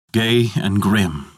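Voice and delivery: plain reading voice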